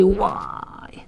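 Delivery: unvoiced, soft why of bad news; whispered why. Shown as a demonstration. Rabbi demonstrating the cry of bad news, whispered. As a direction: breathe why as a pained sigh. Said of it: "demo of pain cry".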